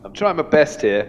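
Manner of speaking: half-serious outburst at the host; hammed-up indignant complaining; mock angry rant. Comic mock-ranting at host.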